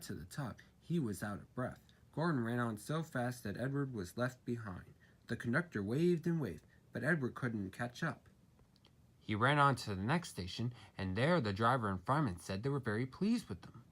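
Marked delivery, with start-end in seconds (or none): none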